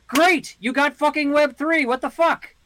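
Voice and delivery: high, loud frustrated outburst; exasperated shout. Real, not acted. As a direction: throw up your hands and yell.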